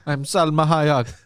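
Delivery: mock Jamaican psychic voice, drawn out; lightly done Miss Cleo Jamaican accent; a hint of a Jamaican TV psychic. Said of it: "You were doing Miss Cleo".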